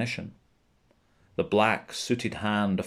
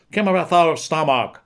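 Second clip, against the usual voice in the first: raised, louder narrated shout in Gaelic; shouted Gaelic warning, higher and louder; 5 semitones higher, 8 dB louder than usual. A narrator's reading. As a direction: call it out like a warning into a dark cave. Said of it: "spoke Gaelic loudly, as if he were shouting warnings".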